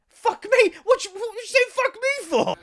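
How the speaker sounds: hammed-up outrage at the lad swearing at him; indignant squeal. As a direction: squawk back in offended disbelief. Indignant retort to the stranger.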